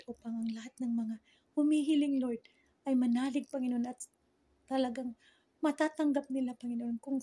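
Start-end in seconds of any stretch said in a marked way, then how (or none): none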